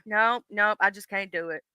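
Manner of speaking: ordinary delivery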